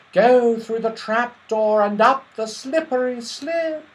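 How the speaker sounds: high, loud voice of the trees; leafy tree voices. A narrator's reading. Trees repeating the way to the children, high and clear. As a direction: call it out like a chorus of trees. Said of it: "in their leafy voices".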